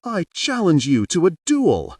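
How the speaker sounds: high, loud challenge; little boy shouting a duel challenge; child's shout. A narrator's reading. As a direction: shout it like a cartoon hero. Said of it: "Ryota shouted".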